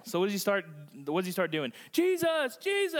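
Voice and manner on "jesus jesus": high-pitched mock calling out; imitated yelling. Blind man calling to Jesus through the crowd, mock yelling. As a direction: call the name high, imitating a desperate yell. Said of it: "He starts yelling".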